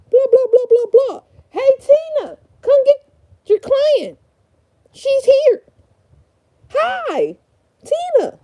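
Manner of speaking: high, bright-eyed front-desk greeting; receptionist voice. Pretend. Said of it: "little reenactment".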